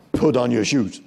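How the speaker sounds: loud, direct order; firm demand. Shown as a demonstration. Staff walking up to place a demand, loud firm order. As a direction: give a command straight at the person. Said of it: "when we place demands, we normally do like this".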